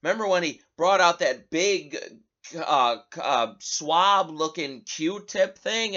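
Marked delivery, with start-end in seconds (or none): none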